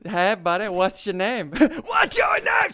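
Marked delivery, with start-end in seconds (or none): 1.9-2.7 drawn-out, high-pitched holler; stretched high shout at an opponent; excited yell. Real, not acted. Repeats greeting as a shout.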